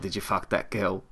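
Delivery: soft, low attempt at a Scottish accent; evil Scottish cat. Evil cat quizzing his owner, in a rough Scottish accent. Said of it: "How's his Scottish accent?".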